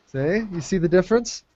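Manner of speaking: normal talking voice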